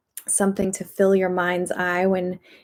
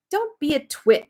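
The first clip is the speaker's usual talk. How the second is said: higher, breezy put-down; dismissive lady; 8 semitones higher than usual. A narrator's reading. Lady brushing off the frightened dentist, breezy. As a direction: lightly brush him off. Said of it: "the lady said".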